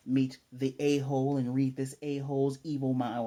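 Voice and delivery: ordinary talk, nothing marked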